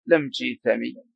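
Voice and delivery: تيثمي with a long "ee" after the t; dialectal kasra prefix. Shown as a demonstration. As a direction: say تيثمي as "teethami". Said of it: "ويجيزون أن يقولوا لم تيثمي".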